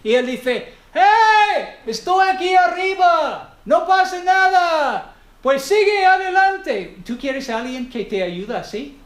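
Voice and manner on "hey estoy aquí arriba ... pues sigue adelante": high, loud shout from a helicopter. Retold or imitated. Man on the summit shouting to the struggling climber, loud and high. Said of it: "empieza a gritarte ahí".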